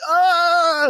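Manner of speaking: high loud yelp of pain; mock scream of pain. Guard bitten by the killer, high loud yelp. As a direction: yelp as the lips get bitten. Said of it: "And then it's like".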